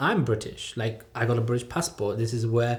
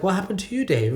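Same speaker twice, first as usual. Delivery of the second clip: high, louder incredulous question; mock bewilderment; 8 semitones higher, 4 dB louder than usual. Friend quizzing Brother Dave on his new ways, mock-bewildered. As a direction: ask it in amused disbelief, voice jumping up.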